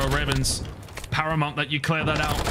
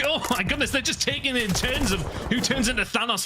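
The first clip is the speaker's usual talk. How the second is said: excited; 8 semitones higher than usual. Real, not acted. Amazed reaction to play.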